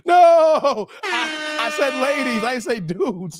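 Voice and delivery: high, loud "No!"; loud protest. Real, not acted. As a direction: shout it in denial. Protesting misread hypnosis.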